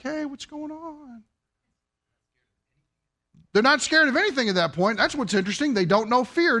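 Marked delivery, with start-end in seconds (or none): 0.0-1.2 much softer and slow, easygoing hello; casual greeting. Pretend. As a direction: greet the snake like an old friend, unbothered. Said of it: "she's just kinda like".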